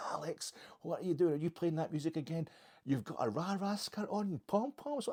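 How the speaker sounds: high-pitched mock American teen-film girl; American teenage girl. Cheerleader scolding Alex over his music, high mock teenage girl. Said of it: "an American teenage lassie".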